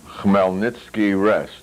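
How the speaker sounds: ordinary delivery, nothing marked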